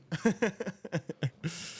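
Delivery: chuckling run of laughter; amused cackle. Real, not acted. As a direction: laugh at your own predicament. Laughs at own bet.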